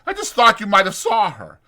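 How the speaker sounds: Curly snapping back at Carlson; angry ranch hand. Acted. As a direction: push back at the accusation. Curly quarrels in the bunkhouse.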